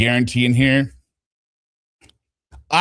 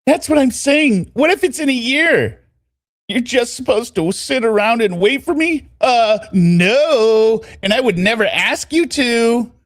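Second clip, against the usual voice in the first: raised, put-on woman's pitch; high-pitched woman's reading voice; 11 semitones higher than usual. Telling him not to wait a year, high put-on voice. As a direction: argue her side in a high voice.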